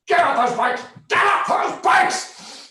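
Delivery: full-out screamed command; park ranger screaming. Retold or imitated. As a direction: bellow the order in a rage. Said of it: "screaming at us to get off the bikes".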